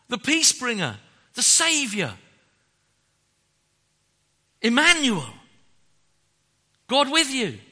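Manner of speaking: loud, high proclaiming; rising preaching. Acted. Preacher proclaiming God's names to the congregation, loud. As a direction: build to a triumphant climax.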